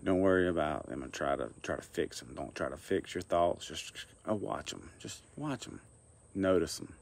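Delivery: soft guided meditation with long pauses; quiet, unhurried meditation guidance. Acted. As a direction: lead them gently, leaving long silences between phrases.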